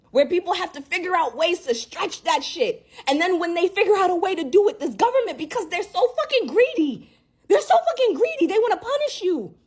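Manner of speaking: real fury at the country and government; furious rant. Real, not acted. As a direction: let the outrage boil over. Furious rant about racism.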